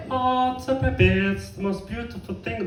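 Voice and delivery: put-on high, soft cooing over a baby; hammed-up tender adoration; mock gushing. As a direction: coo sweetly in a put-on high voice.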